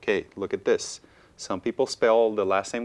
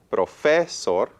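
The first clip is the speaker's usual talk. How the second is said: "Profesor" with wrong stress on FE, louder; stress on middle syllable; 4 semitones higher, 5 dB louder, slower than usual. Shown as a demonstration. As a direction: push the middle of "Profesor": pro-FE-sor. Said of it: "putting stress on all three syllables".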